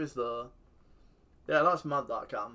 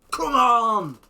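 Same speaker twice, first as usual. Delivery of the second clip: much higher, much louder roar; celebration shout; 11 semitones higher, 15 dB louder than usual. Real, not acted. Celebrating a big goal, loud roar.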